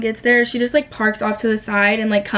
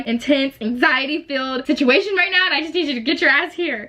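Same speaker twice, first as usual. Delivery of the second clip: higher, strained retold exasperation; pissed-off pleading; 5 semitones higher than usual. Daughter urging her mom to come to the crash, exasperated. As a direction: replay an exasperated plea, pitched up. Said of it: "I was pissed because".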